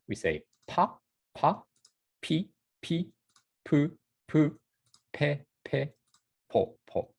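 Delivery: "pa pi pu pe po" drilled, pairs popped; P row. Shown as a demonstration. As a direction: pop each P syllable twice. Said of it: "the P sound".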